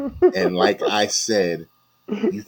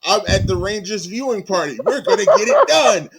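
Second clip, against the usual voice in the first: high, loud giddy fan voice; mock an overexcited fan; imitating Roz; 10 semitones higher, 6 dB louder than usual. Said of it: "that was the look on Roz's face".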